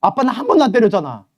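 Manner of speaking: put-on high, loud indignant girl; protesting child. Daughter protesting to her dad and showing her bruises, high indignant.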